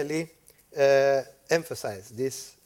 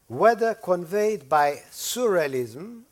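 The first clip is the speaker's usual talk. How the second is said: surrealism underlined, slowed and a little higher; stressed surrealism; 4 semitones higher, 4 dB louder, slower than usual. Shown as a demonstration. Lecturer reading Jameson to students, underlining surrealism. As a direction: underline surrealism as you read it. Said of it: "underline the word surrealism".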